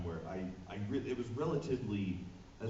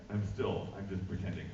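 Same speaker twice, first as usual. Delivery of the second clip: ordinary talk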